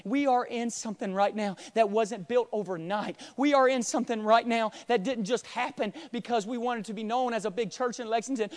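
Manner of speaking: usual speaking voice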